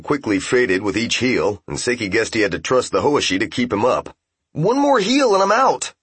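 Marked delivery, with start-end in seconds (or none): 4.5-5.9 warning shout. A narrator's reading. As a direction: call out the warning sharply. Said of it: "warned Kentaro".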